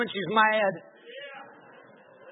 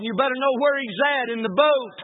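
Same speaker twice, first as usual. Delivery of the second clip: ordinary delivery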